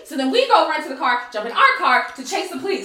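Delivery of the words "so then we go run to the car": ordinary talk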